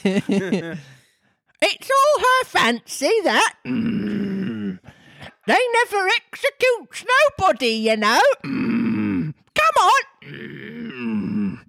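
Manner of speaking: very high, very slow squawk; Griffin voice. Acted. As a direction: stretch each word like a parrot. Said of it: "I'm crying".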